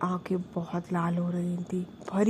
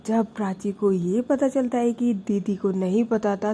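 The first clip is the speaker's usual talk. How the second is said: usual speaking voice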